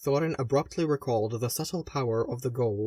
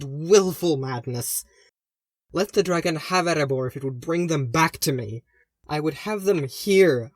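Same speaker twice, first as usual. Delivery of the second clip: building from angry to an anguished higher, louder cry; rising anguish; 4 semitones higher, 6 dB louder than usual. Acted. Grieving sister raging at her lost family, rising to anguished shouting. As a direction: let the grief boil over into shouting. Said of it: "her voice began to rise with barely contained anguish".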